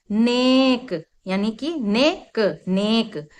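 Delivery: नेक said slowly with a long ए; slow demo word. Shown as a demonstration. Teacher slowly pronouncing नेक for children. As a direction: draw out नेक, repeating it clearly. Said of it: "तो इसको हमने बोला ने".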